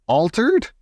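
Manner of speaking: high, startled query, rising; mock shout of outraged disbelief; mild indignant shout. A narrator's reading. Father to grandmother on news of his son, mildly shouted. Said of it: "shouted Mister Jenkins".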